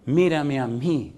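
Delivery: quiet, slow mírame, almost intimate; soft call. Retold or imitated. Pastor voicing God's call softly to the church. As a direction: let God's call fall gently. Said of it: "dice Dios".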